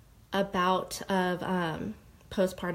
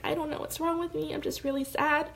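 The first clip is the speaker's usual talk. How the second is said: much higher, strained tearful voice; tearful retelling; 8 semitones higher than usual. Retold or imitated. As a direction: voice climbing as the tears come. Retelling breakdown to her dad.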